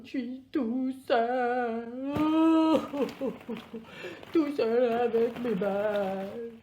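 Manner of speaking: drawn-out, hammed-up self-pity; mock wailing. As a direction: wail theatrically about being left alone. Acts out being all alone.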